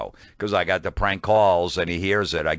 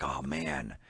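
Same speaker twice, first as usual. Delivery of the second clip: much softer, lower mock letdown; deflated; 4 semitones lower, 10 dB quieter than usual. Young comics rebuffed by Dice, soft deflated sigh. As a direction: let the air out, crushed. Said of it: "me and Norton are standing like".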